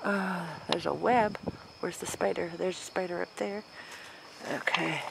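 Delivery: ordinary talk, nothing marked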